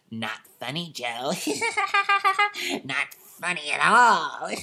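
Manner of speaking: creepy little gnome voice with high cackles; giggling gnome. Retold or imitated. Voice behind Joe taunts him, giggling gnome voice. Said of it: "Does it giggle?".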